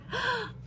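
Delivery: high, sharp intake of breath; mock horrified gasp. Acting out the screaming figure for pupils, horrified gasp. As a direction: gasp as if aghast.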